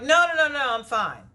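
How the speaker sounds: high, loud frantic no no no; urgent refusal. Retold or imitated. Refusing the ambulance Shaquita offers, high frantic protest. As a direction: wave them off in a panic.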